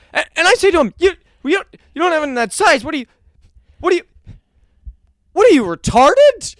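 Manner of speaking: high, loud building outrage; mock indignant rant. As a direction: riff with escalating fake fury. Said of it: "and he goes".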